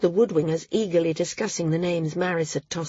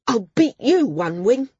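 higher, louder angry retort; snapping back; 5 semitones higher, 7 dB louder than usual. A narrator's reading. Kerr retorts to Val's taunt, snapping back. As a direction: fire the challenge straight back, heated. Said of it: "Kerr snapped back".

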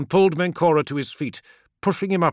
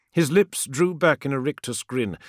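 usual speaking voice

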